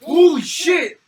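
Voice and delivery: loud shocked yell; shocked shout. Real, not acted. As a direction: blurt it out in pain. Sudden burn from nasal spray.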